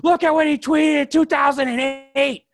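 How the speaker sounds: high, loud, slow mocking shout; shrill out the outrage like a gossip digging up old tweets; hammed-up pearl-clutching yell; mocking outraged tweet-diggers. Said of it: "they're like".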